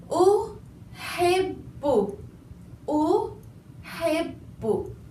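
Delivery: Uhibbu very slow with a held bb; doubled b. Shown as a demonstration. Teacher gives the last example, very slow. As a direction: draw out uhibbu, lean on the bb. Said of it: "The last example".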